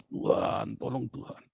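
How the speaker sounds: hushed, breathy plea to God; whispered prayer. Retold or imitated. Praying desperately when beaten down. (doa berbisik lirih)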